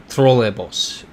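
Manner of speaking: "trolleybus" said slowly as troh-ley-boos; Russian trolleybus. Shown as a demonstration. Teaching chat the Russian word, slowly saying troh-ley-boos. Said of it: "I didn't wanna do the accent".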